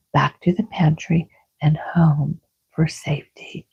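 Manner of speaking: ordinary delivery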